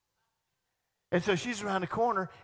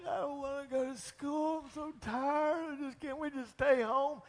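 put-on whiny tired child; 9 semitones higher than usual. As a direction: whine like a child begging to stay home. Child whining about school.

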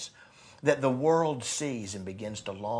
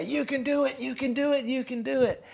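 high, ringing cheer through a bullhorn; bullhorn shout; 11 semitones higher than usual. Pretend. Preacher shouting encouragement to the church through a bullhorn. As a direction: yell it up high like a coach cheering. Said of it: "I'm gonna shout it, get the bullhorn and say".

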